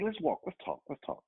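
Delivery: put-on high, soft little-girl voice; high-pitched kid impression; child's talk. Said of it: "This is how she's talking to me".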